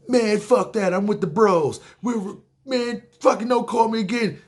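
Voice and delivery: high, agitated mock rejection; hyped-up brush-off. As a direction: act out an amped-up gym bro blowing someone off. Acting out Brad rejecting girlfriend's call.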